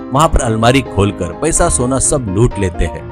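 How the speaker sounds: usual speaking voice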